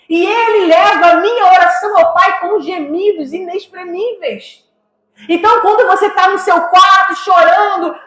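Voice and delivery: a climbing, near-shouting pulpit cry; fervent preaching. Acted. Pastor pleading for the crying believer, full-force preaching.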